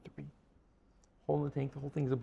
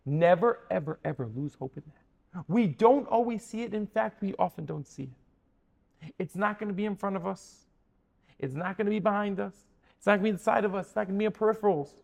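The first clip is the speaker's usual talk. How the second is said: higher-pitched, insistent exhortation; impassioned preaching; 5 semitones higher, 4 dB louder than usual. Real, not acted. Preacher urging congregation to keep hope, impassioned preaching. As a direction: press each repeated phrase with conviction.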